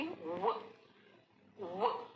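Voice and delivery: व with rounded lips like ओ; W sound. Shown as a demonstration. Teacher shows W as rounded व. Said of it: "उसको ओ की तरह करके".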